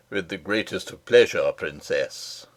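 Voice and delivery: a deep-voiced, gallant soldier answering with a bow; gallant captain. A narrator's reading. Captain answers the little princess, low and gallant. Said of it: "answered the captain of the gentlemen-at-arms".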